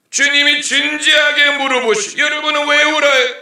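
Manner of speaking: full-volume preacher's call; preaching at full force. Acted. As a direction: cry it out from the pulpit. Preacher repeats the Lord's questions.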